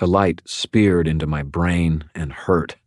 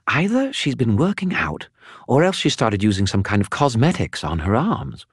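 higher, chattering spirit voice; a talkative skull spirit, pitched up; Bob the skull; 5 semitones higher than usual. Acted. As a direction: prattle on like a gossipy spirit. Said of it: "Bob the skull speaks".

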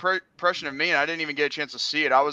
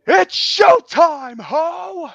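high, slow, drawn-out showtime call; announcer catchphrase; 13 semitones higher, 11 dB louder, much slower than usual. Pretend. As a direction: belt it like a ring announcer. Host's catchphrase, announcer style.

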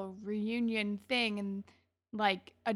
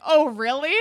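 loud, higher mocking comeback; mock amused disbelief; 8 semitones higher, 8 dB louder than usual. Boy laughing at pink hair girl, loudly amused. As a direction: imitate someone laughing off a clueless question, loudly. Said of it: "he, like, starts laughing at her".

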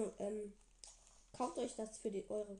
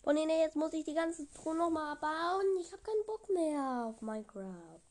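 genervt; 6 semitones higher, 7 dB louder than usual. Real, not acted. Muss Truhe neu bauen.

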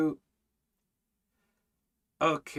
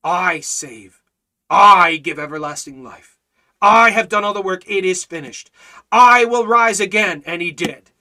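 loud, fervent proclamation, hard on each "I"; full-force preaching; 3 semitones higher, 10 dB louder than usual. Real, not acted. Preacher proclaiming what the Lord says, full-force preaching. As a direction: thunder it out from the pulpit.

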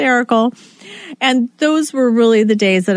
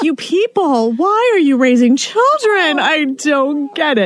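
higher, slow, disbelieving scold; exasperated; 5 semitones higher, slower than usual. Retold or imitated. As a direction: scold the parents, half laughing. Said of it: "It's like".

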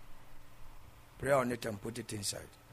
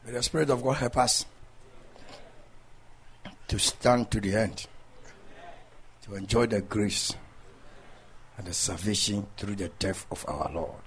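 preaching; 2 semitones lower, 4 dB louder than usual. Acted. Preacher closing blessing.

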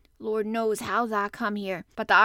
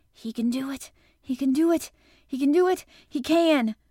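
rising, louder with each repeat; will him on, building higher and more urgent; urgent gabble; 5 semitones higher, 8 dB louder than usual. A narrator's reading. Girl urging the boy on to herself, excited gabble. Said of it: "gabbled over to herself under her breath as fast as ever she could".

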